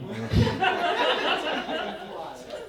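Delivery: high, giggly chuckle; giggle at own joke. Pretend. Comic giggling at his own notebook joke. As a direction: giggle like you find yourself hilarious.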